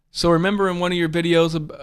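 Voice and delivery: normal talking voice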